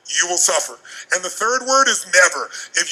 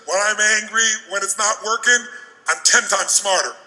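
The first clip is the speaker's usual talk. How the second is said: usual speaking voice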